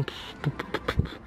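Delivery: soft unvoiced puffs of hesitation; puffing breaths. Real, not acted. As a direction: blow out air while thinking. Hésite sur quoi faire évoluer. (souffles hésitants)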